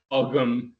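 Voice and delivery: Ogham as "og-um", g sounded; sounded-G pronunciation. Shown as a demonstration. Listing variant pronunciations, Ogham as "ahg-um". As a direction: say Ogham with a short "ah" and a g. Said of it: "also about the pronunciation of".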